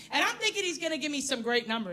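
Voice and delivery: ordinary delivery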